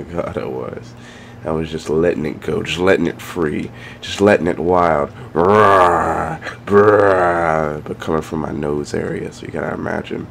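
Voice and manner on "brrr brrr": dinosaur snort sound. Retold or imitated. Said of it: "I sound like a dinosaur".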